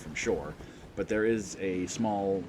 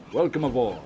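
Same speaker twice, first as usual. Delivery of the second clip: loud, higher-pitched captain's greeting; smiling welcome; 5 semitones higher, 9 dB louder than usual. Acted. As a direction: greet them loudly with a smile. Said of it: "He kinda smiles".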